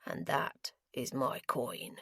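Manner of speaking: low gravelly voice. Acted. Gambler refusing to give up his coin, low. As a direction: rumble it low and slow. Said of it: "in a low, gravelly voice".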